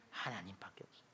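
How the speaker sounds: breathy whispered answer. Real, not acted. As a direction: whisper it like a secret truth. Answer to rhetorical question. (속삭이듯)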